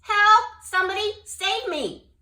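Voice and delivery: high, loud desperate shout; shouting for help. Acted. Jubal stranded in the flood, shouting. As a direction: scream for rescue. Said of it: "he shouted".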